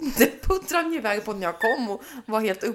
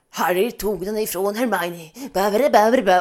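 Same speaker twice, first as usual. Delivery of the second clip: mock audiobook reader; 6 dB louder than usual. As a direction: lightly mimic the audiobook narrator. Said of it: "Och då är det så här". (härmad ljudboksuppläsare)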